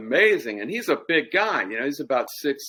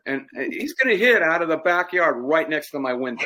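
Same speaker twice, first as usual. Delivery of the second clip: usual speaking voice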